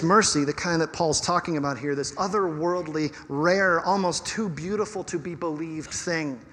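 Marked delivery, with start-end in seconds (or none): none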